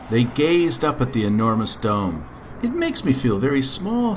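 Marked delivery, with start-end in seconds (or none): 2.6-4.2 put-on high, childlike voice; high-pitched storybook child; small boy Jack. A narrator's reading. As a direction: speak up high as a little boy. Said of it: "said Jack".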